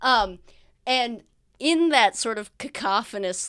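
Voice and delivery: usual speaking voice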